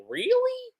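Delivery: very high, squeaky incredulous question; mock disbelief. Reviewer recalling their reaction to him stripping down, incredulous. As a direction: pitch leaps way up in exaggerated bafflement. Acting own baffled reaction.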